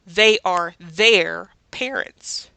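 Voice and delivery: They are over there said slowly, each word spelled out; teacher voicing the full form they are; slow, deliberate example. Shown as a demonstration. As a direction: model it slowly and clearly. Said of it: "I always fully pronounce it".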